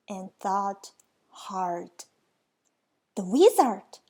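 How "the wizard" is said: loud, excited cry of discovery. Acted. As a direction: shout it as the idea suddenly hits. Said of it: "cried Mister Happy".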